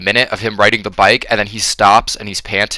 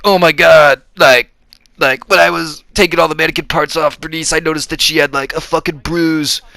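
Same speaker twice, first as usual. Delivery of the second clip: higher, put-on distressed hero; Cage impression; 6 semitones higher than usual. Cage recalling the bruise on Bernice, higher hammy impression. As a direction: act out a hammy Cage having a realization. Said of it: "Cage is like".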